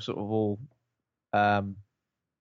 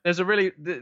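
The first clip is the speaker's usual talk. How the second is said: ordinary talk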